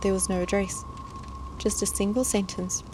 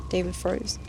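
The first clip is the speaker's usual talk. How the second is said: ordinary delivery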